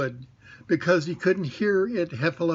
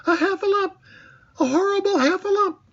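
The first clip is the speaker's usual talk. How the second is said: very high, louder panicked squeal; Piglet crying help; 4 dB louder than usual. A narrator's reading. Piglet sees the Heffalump and cries out in high panic. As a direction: shriek for help like a terrified little pig. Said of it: "cried Piglet".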